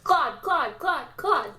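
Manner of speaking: calling out. Acted. Calling out repeatedly.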